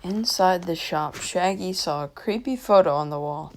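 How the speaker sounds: usual speaking voice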